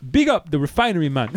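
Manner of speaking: loud, high fake Jamaican; hammed-up Jamaican patois; Jamaican accent. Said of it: "do a Jamaican accent".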